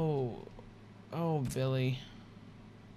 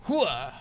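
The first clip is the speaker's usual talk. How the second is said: loud gung-ho soldier; 6 dB louder than usual. Pretend. Gung-ho battle cry in game.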